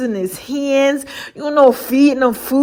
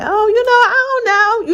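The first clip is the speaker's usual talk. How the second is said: coy shrug; 10 semitones higher than usual. Retold or imitated. Shrugging off boyfriend's compliment.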